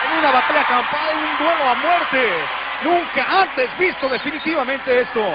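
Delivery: high, fast alarmed live report; excited reporting. Real, not acted. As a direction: report the chaos urgently. Reporter alarmed by backstage brawl.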